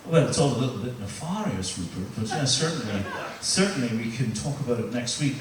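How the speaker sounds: imitating himself going posh on the phone; a hint of posh English accent. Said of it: "my accent changes to match them".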